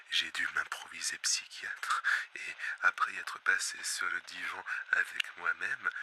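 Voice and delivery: soft, low whisper. Acted. Host whispering his confession to listeners. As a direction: confide it in a hushed breath. Confiding opening lines, unvoiced.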